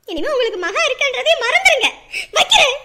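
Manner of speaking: loud, final cut-off; angry. Acted. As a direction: build to a loud hang-up. Daughter cuts off parent, hangs up. (கோபமாக உறவை முறித்து)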